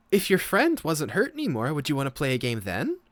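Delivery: put-on high, eager little girl asking sweetly. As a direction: perk up like a hopeful child. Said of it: "she kinda, like, perks up".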